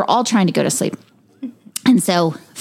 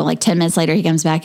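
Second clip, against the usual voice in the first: normal talking voice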